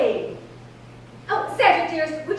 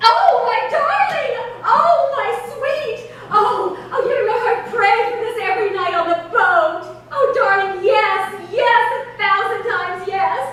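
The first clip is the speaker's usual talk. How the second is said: higher, louder, laughing, slow and swooning; ecstatic gushing; 4 semitones higher, 3 dB louder than usual. Acted. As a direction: swoon over the proposal with laughter. Accepting marriage proposal on phone.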